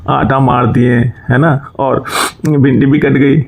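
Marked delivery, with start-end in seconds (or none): none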